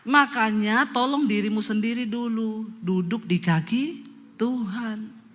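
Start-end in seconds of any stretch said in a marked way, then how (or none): none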